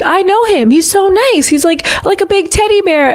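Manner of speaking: high, gushing voice of a starstruck friend. Retold or imitated. Friend defends [name] in a high, gushing voice. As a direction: gush like a fan defending him. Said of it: "She's like".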